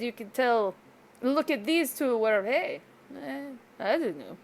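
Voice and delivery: usual speaking voice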